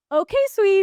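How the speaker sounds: high, loud, playful singsong; playful, fun. Shown as a demonstration. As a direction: toss it out as a fun, cheeky cheer. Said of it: "Which is, like, kind of fun".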